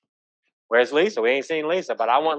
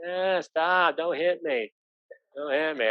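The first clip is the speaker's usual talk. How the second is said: higher, softer mock whimper; mock pleading; 4 semitones higher, 6 dB quieter, slower than usual. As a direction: play a timid victim begging not to be hit. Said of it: "I don't mean".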